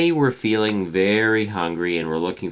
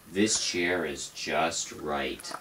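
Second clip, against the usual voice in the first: slow and pleased; 3 semitones lower, 4 dB quieter, much slower than usual. Acted.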